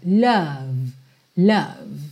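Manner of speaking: "love" with a vowel between O and A. Shown as a demonstration. A teacher showing the vowel in "love". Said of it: "É entre o O e o O".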